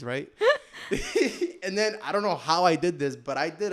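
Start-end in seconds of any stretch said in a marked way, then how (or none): 0.3-1.6 squealing laugh; high burst of laughter. Real, not acted. Laughs at own fall story.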